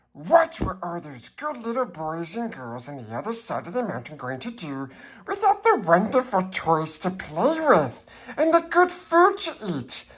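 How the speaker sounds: usual speaking voice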